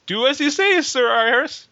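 high, hammed-up urging. A narrator's reading. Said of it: "Dre laughs urged".

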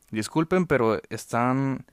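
loud, slow, firm complaint. Retold or imitated. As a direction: call them out loudly and firmly. Confronting ladies in line.

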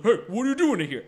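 gruff guard calling out; like a game security guard. Retold or imitated. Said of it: "they're like".

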